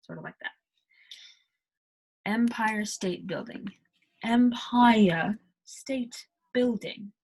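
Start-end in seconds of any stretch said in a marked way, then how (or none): 2.2-7.1 "Empire" said slowly as em-pie-uh; British accent. Shown as a demonstration. Teacher demos "Empire" to learners. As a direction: stretch out "Empire". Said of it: "Building is normal".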